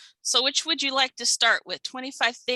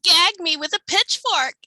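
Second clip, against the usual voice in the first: put-on high, loud Valley girl slang; 8 semitones higher, 7 dB louder than usual. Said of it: "I'm being goofy".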